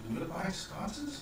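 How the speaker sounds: soft whispered question; Irina whispering. Acted. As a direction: whisper it conspiratorially. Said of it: "she says".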